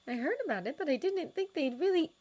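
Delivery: Miss Hooper voice. A narrator's reading.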